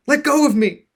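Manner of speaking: high, loud desperate scream. Acted. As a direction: scream for help. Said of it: "Vax screamed".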